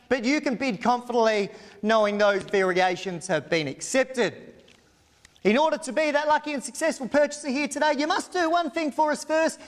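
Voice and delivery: brisk, formal auction terms read aloud; auctioneer's formal read. Acted. Auctioneer reading contract terms to the crowd, formal and brisk. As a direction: announce the conditions of sale crisply to the crowd.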